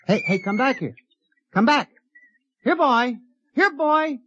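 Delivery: urgent calls climbing very high; increasingly desperate high-pitched pet call; calling after a runaway. Acted. Calling after the escaping creature.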